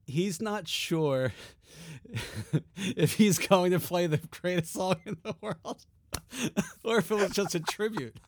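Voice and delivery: high voice, breaking into laughs; laughing. Real, not acted. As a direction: fight through giggles. Cracking up retelling the quote.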